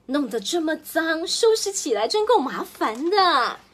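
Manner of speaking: annoyed grumble. Acted. Mother complaining about dirt.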